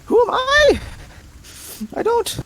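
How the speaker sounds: very high, odd little creature; squeaky creature voice. Acted. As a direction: squeak every line way up high. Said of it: "Sounds like a cat".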